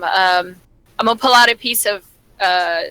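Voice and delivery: ordinary delivery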